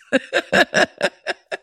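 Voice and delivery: long high giggling laugh; real delighted laugh; burst of laughter. Real, not acted. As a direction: crack up helplessly. Laughing at her own joke.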